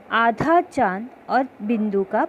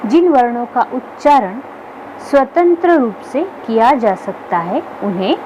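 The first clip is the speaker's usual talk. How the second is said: louder, deliberate textbook read; reading definitions aloud; 6 dB louder than usual. Acted. Teacher reading the textbook definitions aloud to the class, louder and deliberate. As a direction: announce each definition clearly to the class.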